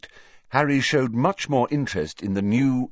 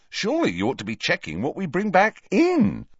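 higher-pitched, cheeky schoolboy protest; cheeky Ron; 11 semitones higher than usual. Acted. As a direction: argue back with indignation.

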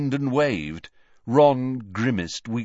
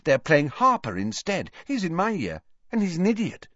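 put-on high, upbeat girl's voice; breezy, dismissive gossip; bright; 9 semitones higher than usual. A narrator's reading. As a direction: carry on brightly, pitched up. Ginny continuing brightly.

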